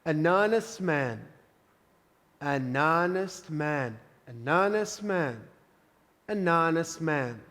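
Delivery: "an honest man" slow, linked as "an anest man"; slow drill. Shown as a demonstration. Teacher drilling the phrase slowly for students. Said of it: "Em todos esses Hs ... não teve o som".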